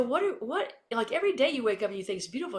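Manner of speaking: high-pitched, sputtering disbelief; retold baffled protest; mock bafflement. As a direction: stumble over it, acting incredulous. Acts out her bafflement at teammate.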